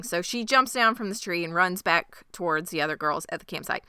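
ordinary delivery

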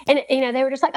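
plain reading voice

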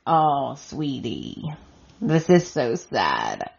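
low, slow sympathetic aside; pitying sympathy. Real, not acted. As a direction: ache for her, dropping low and slowing down. Reacting with pity to retold scene.

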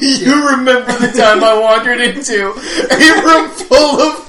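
talking through laughter; laughing. Real, not acted. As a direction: laugh while telling it.

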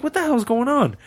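high-pitched incredulous question; baffled disbelief. Retold or imitated. Driver reacts to the man wearing no shoes, baffled and high-pitched.

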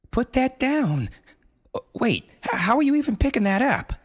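high, anxious mother voice; rushed, pleading mother; frantic mother. A narrator's reading. Mother begging her son to put the boulder down, frantic.

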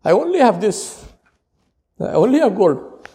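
humble, pleading voice; pleading servant. Acted. A servant pleading before his Lord. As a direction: offer it up like a humble beggar. Imagined plea before Allah.

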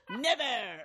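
defiant movie quote. Pretend. Quoting a movie scene to her cohost, defiant laughing shout. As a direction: shout it back through a laugh. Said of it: "If you knew what that reference was from".

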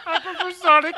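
mocking a little kid's whine; whiny little brother. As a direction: play the little brother nobody listens to. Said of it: "He sound like your little brother in the backseat".

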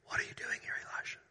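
hushed, unvoiced whisper; barely audible, breathy whispered question; low whisper. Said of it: "of a low whisper".

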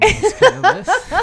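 laughing loudly mid-sentence. Real, not acted. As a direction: giggle through the line. Laughing joke about the list.

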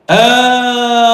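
high, loud, drawn-out cry; shouted call. Acted. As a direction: call out the word long and hard. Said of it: "it's when she's shouting".

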